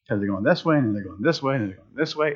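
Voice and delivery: low, soft sing-along of tedium; sleepy drone. Acted. Preacher mimicking dull TV sport, sleepy drone. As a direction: drone it quietly, as if nodding off.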